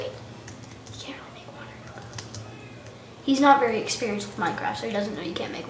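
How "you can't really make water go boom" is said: slow, hushed whisper; breathy aside whisper. Real, not acted. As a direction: whisper it behind his back.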